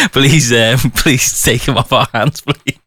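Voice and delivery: helpless laughs breaking up the pleading; laughing plea. Real, not acted. Radio host begging listeners to collect a studio pest, laughing. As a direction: beg through bubbling laughter. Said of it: "I'm just laughing at the thought".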